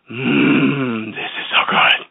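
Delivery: ordinary talk, nothing marked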